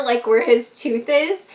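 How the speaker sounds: ordinary talk, nothing marked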